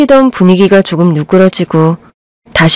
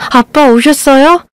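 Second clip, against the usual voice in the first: higher, slow eager greeting; glad surprise; 7 semitones higher, slower than usual. A narrator's reading. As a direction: welcome your dad in relief. Bride greets arriving father.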